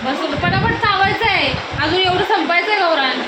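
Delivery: high, firm nagging; scolding. Real, not acted. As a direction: hurry him up sternly.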